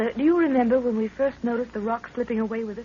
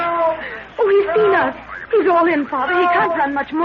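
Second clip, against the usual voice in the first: higher and louder, urgent and breathless; alarmed urgency; 4 semitones higher, 4 dB louder than usual. Acted. Daughter urgently tells her father the fleeing man is spent. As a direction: cry out to warn that the man can't last. Man chased by apes toward them.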